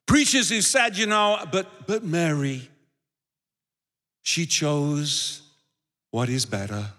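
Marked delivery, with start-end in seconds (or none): 1.5-6.9 low, slow, overly holy sermon voice; mock spiritual preacher. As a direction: drag out "she chose" like a pious preacher parodying himself. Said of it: "You gotta say it like that to make it sound really spiritual".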